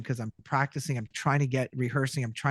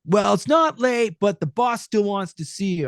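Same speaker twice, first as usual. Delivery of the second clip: cartoony ad read; 6 semitones higher, 4 dB louder than usual. Shown as a demonstration. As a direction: belt it out like an old cartoon host, loud. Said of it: "it started off by going".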